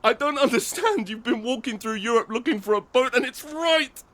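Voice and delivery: high, crying plea, slowing on right there; tearful pleading. Acted. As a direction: sob the words out in disbelief. Man pleading, crying, boat nearby.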